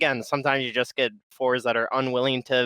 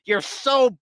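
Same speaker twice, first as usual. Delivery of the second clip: hammed-up berating of a useless teammate; mock berating; 7 semitones higher, much slower than usual. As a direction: draw out the insult in exaggerated exasperation. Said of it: "berate them and be like".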